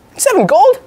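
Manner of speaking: high excited reveal; triumphant announcement. Acted. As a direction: land the big number proudly. Triumphant climax of medal list.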